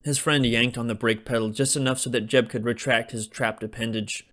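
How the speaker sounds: plain reading voice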